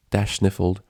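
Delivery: plain reading voice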